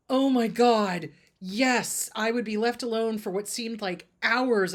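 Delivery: loud, slow "Oh my God", stunned; shocked realization. Retold or imitated. Client recalling being left alone in the crib, loudly stunned. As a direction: blurt it out loud as it hits you.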